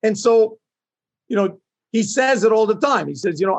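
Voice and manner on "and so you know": ordinary talk, nothing marked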